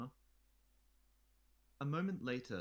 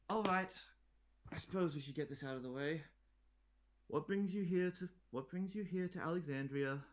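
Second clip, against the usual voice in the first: bored; 8 semitones higher, 4 dB louder than usual. Acted. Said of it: "very clearly bored".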